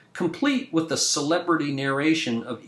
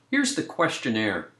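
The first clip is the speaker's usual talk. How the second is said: normal talking voice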